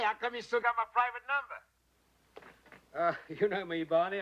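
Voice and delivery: ordinary talk, nothing marked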